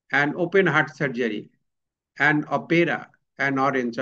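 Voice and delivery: very slow, each word apart; slow example. Shown as a demonstration. Teacher reading examples slowly to the class. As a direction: read an open field out slowly. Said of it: "যেমন one".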